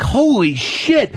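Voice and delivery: stunned. Retold or imitated. Hearing the surgery plan, stunned and very slow. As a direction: let it sink in, slowly. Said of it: "I'm like".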